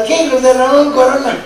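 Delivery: high, loud mock taunt; re-enacted jeer at full voice; imitated crowd shout. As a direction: yell it out like a heckler at a bullfight. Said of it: "se seguía gritando".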